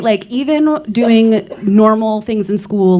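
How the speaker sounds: ordinary talk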